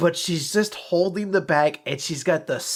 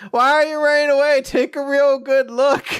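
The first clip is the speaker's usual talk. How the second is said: high, louder teasing call after him; 12 semitones higher, 5 dB louder than usual. Retold or imitated. A girl chasing a fleeing boy, high playful call. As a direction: chase him down, calling out playfully. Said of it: "She's like".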